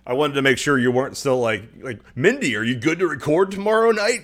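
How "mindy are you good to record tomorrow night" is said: high, loud, cheerfully oblivious ask; over-bright, pushy shout to a friend; mock-eager check-in. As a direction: blurt it out loud and high, as a joke. Acting out himself texting from hospital.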